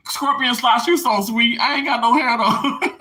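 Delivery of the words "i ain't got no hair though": high voice breaking into laughter; tickled, laugh through the words. Real, not acted. Answering a sweet viewer comment, laughing. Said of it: "I'm laughing and crying simultaneously".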